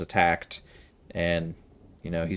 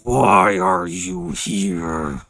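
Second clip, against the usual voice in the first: very slow, louder monster voice; slow creature question; 7 dB louder, much slower than usual. Acted. Varg asks the party why they came, slow and booming. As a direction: drag every word out like a wary beast. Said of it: "and go".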